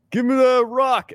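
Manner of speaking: high, slow demanding ogre voice. Acted. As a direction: grab at it like a greedy ogre. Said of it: "do your ogre voice".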